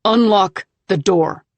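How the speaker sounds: loud, slow, angry demand; angry husband demanding she open up; pissed. Acted. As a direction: order it through the door, furious. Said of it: "He sounds pissed".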